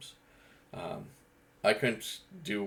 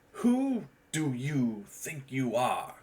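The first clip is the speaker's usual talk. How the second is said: slow, drawn-out challenge; a slow, deliberate confrontation; tonal acting demo; 5 semitones higher, 3 dB louder, much slower than usual. Shown as a demonstration. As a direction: lean on the cadence of every word. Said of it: "type of acting where it just worked on tonality and cadence".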